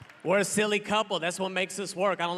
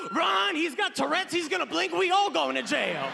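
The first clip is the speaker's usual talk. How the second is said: panicked yell; 10 semitones higher than usual. Retold or imitated. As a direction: shout it in panic, voice up high. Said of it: "He panicked. He lost it".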